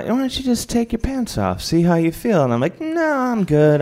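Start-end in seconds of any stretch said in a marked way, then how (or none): 0.0-2.4 high-pitched mock coaxing; lightly voiced pushy friends. Guests urging Dave to strip, coaxing. 2.8-3.8 high, drawn-out polite no; awkward refusal. Retold or imitated. Dave declining to take his pants off, awkward refusal.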